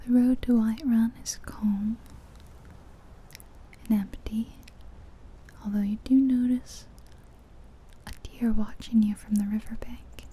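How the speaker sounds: plain reading voice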